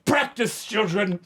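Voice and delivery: high, sing-out classroom call; mock teacher command. Teacher dismissing children to practice, high-pitched mock command.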